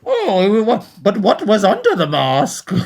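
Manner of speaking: high, muffled masked-villain voice; Bane impression. Podcaster jokes about an interview in character, Bane voice. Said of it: "does the Bane voice".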